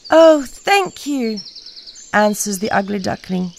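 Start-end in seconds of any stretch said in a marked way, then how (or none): 0.1-1.4 1 slow grateful. Acted. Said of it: "duckling grateful".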